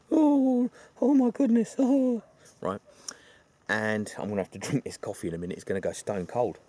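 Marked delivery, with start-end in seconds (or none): 0.1-2.2 high, loud, hammed-up wail; mock wailing woman. Retelling the film, [name] wails in a hammed-up high voice. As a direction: play a hysterical weeping woman for laughs. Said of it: "typical booing woman".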